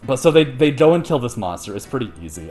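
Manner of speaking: ordinary talk, nothing marked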